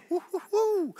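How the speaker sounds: rising run of high whoops; excited whooping. Real, not acted. Preacher marvelling at the outpouring, excited whooping.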